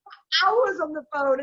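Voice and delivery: high, drawn-out mock dismay; exasperated. Matchmaker voicing her frustration at picky clients, exasperated. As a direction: throw up your hands at them.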